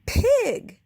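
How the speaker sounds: high, loud shout of one word. A narrator's reading. Eugenia shouting at the pig through the window, loud shout. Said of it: "shouted Eugenia".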